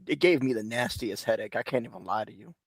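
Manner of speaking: usual speaking voice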